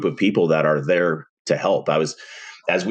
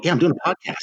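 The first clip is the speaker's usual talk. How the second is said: much higher, bright eager yes; retold excited reply; 11 semitones higher, much faster than usual. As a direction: blurt it out like happy news. Retelling wife conversation, own reply.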